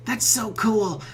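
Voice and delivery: a hint of a wistful troll; lightly voiced troll. Troll reacts to your story.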